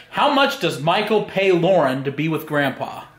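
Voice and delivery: ordinary delivery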